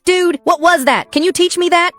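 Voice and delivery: put-on very high, loud kid's yell; excited yell. A narrator's reading. Kid in the back of the gym after the fight, excited yell. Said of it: "Some kid in the back yells".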